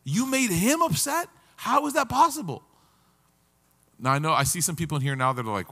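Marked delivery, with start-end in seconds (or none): 0.0-2.6 very high, incredulous accusation; imitating scandalized siblings; appalled siblings. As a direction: squeak it out in outraged disbelief. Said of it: "my siblings would be like".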